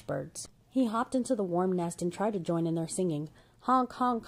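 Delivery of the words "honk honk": high honking duckling call. A narrator's reading. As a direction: honk like an off-key young duck. Duckling tries singing with birds.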